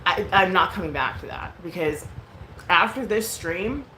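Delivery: ordinary talk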